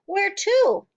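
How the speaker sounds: high, loud question; crying out. A narrator's reading. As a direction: demand an answer in alarm. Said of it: "Matilda cried out".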